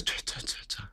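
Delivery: whispered mock stammering tuh sounds. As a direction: imitate someone flustered and lost for words. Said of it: "And then she goes".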